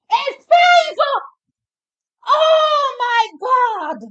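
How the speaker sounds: screaming very high and loud; ecstatic screaming. Real, not acted. Fan screaming when the jump measures eight five. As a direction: scream the mark out in joy. Hearing the mark 8.5.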